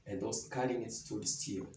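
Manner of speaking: soft, low Bible reading; hushed reading of the Gospel; scripture reading. Acted. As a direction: read the passage quietly, like a lesson. Scripture reading before the sermon.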